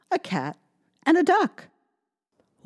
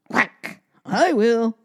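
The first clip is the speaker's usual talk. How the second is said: louder quacking duck; 4 semitones higher, 3 dB louder, slower than usual. Acted. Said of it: "quacked the duck".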